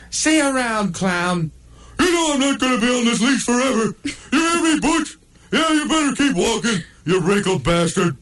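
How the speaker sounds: the pug yells after Butch, high and furious; high yelling pug. Acted. Pug Buzz yells after the bulldog, high and fast.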